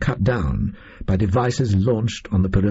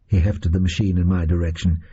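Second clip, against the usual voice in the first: ordinary delivery, nothing marked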